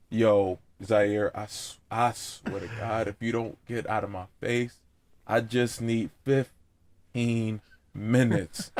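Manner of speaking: a tired dad quietly pleading for a nap; sleepy threat. Retold or imitated. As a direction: grumble it half-asleep from the couch.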